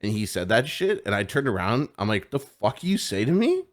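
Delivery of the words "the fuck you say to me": confrontational. Retold or imitated. Confronting the insulting customer.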